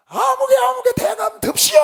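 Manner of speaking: high, loud drawn-out ritual call; shaman's summoning. Retold or imitated. Shaman calling the spirit lords in at the door, loud ritual summons. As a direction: cry out to summon spirits, stretching the words. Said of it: "여기 무당이 서서".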